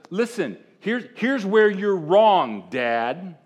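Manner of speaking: half-acted older son putting his father right; a hint of a son lecturing his dad; correcting his father. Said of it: "he's like".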